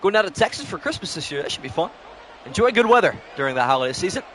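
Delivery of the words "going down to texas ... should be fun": normal talking voice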